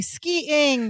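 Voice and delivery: high, drawn-out Skiing with the ending stretched; recalling Balki's excited Skiing; Balki imitation. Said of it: "remember when he used to say".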